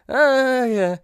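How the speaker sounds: high, drawn-out "ah, yeah"; doubtful reaction. Acted. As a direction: stretch it out, humoring a silly idea. Mock reaction to training scene.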